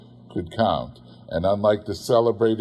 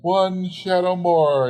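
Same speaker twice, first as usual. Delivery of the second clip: slow, higher, relentless; the spirit pressing him on, slow and higher; ghostly exclamation; 9 semitones higher, slower than usual. Acted. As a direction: insist on one more vision, slowly. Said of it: "exclaimed the ghost".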